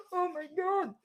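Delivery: high mock sob; hammed-up little whimper, badly faked; tearless fake crying. Host mimics woman crying without tears, mock crying. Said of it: "Walang luha".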